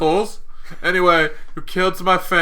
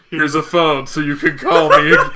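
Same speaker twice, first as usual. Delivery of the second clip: louder mock message collapsing into laughs; breaking into laughter; 5 dB louder than usual. Quoting Cap's gift to his ex-boyfriend, cracking up laughing. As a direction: crack up on the last words.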